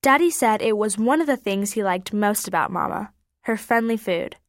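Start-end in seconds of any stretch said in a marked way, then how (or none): none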